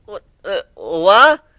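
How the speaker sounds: high, loud startled double take; shocked disbelief. Acted. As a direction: blurt it out, stunned. Whiplash shocked at Growl eating.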